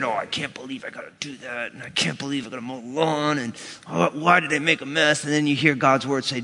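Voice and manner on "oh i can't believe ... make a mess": low, soft mock grumbling under the breath; grumbly. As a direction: act out quiet complaining to yourself. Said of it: "getting grumbly in your spirit".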